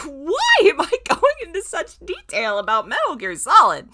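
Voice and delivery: high and loud, laughing through it; can't stop giggling at himself. Real, not acted.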